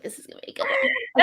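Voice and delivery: high, fast hype shout; excited shout. Acted. Hyping up the reaction.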